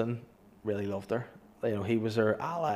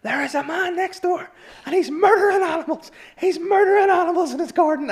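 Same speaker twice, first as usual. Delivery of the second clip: very high, loud shrieking caller; hammed-up hysterical woman; imitating a hysterical caller; 7 dB louder than usual. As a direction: shriek it down the phone in a panic. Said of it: "in hysterics, like, screaming down the phone".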